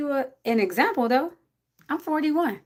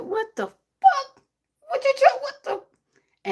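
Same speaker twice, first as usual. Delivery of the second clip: much higher, slow exasperated cursing; mock angry outburst; 4 dB louder, much slower than usual. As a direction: yell at God in disbelief. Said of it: "It be like".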